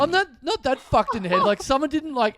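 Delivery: high-pitched, indignant retold protest; exasperated. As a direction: argue back, acting out insulted disbelief. Retold retort to dismissive bank clerk.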